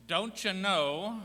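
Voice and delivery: slow, high and loud, sung-out don't you know; Minnesota don't you know. Shown as a demonstration. Speaker showing off a Minnesota phrase, slow and exaggerated. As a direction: stretch it like a Minnesotan. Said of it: "the second one is".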